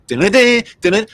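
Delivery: high, loud call for dinner. Acted. Parent calling the kids to dinner, loud.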